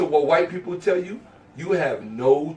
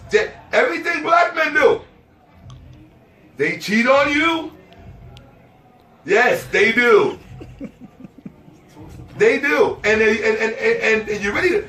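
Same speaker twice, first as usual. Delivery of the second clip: high, loud insistent rant; loud high rant that they do it too; ranting shout; 5 semitones higher, 3 dB louder than usual. Real, not acted. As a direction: shout the point home.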